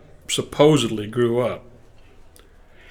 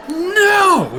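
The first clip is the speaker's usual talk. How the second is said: high, drawn-out mock shout of refusal; exasperated caller; 4 dB louder than usual. Singer correcting the excited mother, high exasperated cry. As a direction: wail it in exasperation. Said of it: "the exasperated voice says".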